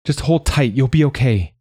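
high, anxious comforting; urgent reassurance. Acted. Manya reassuring hurt Pinyat, urgent. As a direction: reassure while clearly worried.

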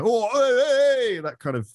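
high, drawn-out hooligan holler; lairy football hooligan. Acted. Showing what yobbish people sound like, lairy hooligan call. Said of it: "Larry football hooligan type".

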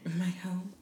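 soft teasing whispered echo; playful whisper. Pretend. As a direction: whisper it back as a joke. Echoes friend's whisper playfully.

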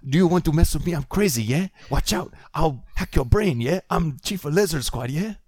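high, hammed-up South African tough talk; South African accent. Hero warning off an enemy, South African accent. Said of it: "It's going to be".

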